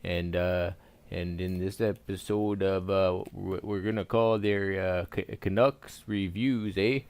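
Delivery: hammed-up Canadian accent; thick comic Canadian accent. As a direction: play it as a folksy Canadian with a sing-song "eh".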